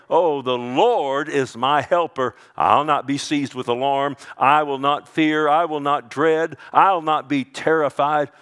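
loud, firm proclamation; bold, confident declaration. Acted. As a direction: declare it boldly, line after line. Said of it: "We confidently, boldly say".

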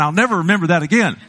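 real laugh through fast words. Real, not acted. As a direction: laugh it out quickly. Laughing at the memory.

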